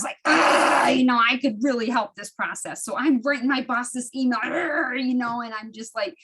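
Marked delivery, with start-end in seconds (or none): 0.3-0.9 loud, raised growl of frustration; exasperated growl. Retold or imitated. Acting out being mad at her coworkers, exasperated growl. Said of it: "I was mad". 4.4-5.0 raised, drawn-out growl of frustration; angry growl. Retold or imitated. Writing the boss an angry email, mock-furious growl. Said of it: "I was mad".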